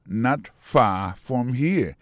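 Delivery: slow, measured "Not far from here"; slow model phrase. Shown as a demonstration. Teacher offers another way to say near, slowly.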